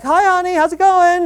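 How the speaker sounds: cheery greeting. Retold or imitated. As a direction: greet your partner with eager cheer.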